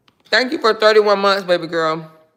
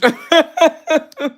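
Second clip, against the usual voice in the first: short squealing cackle; a quick high laugh; 9 semitones higher than usual. Real, not acted. Laughing at the green beans comment, high cackle.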